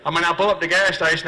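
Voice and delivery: ordinary talk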